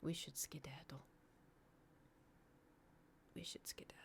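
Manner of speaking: very soft, unvoiced-sounding hushed whisper. Real, not acted. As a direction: whisper it, barely there. Whispering that it's time to go.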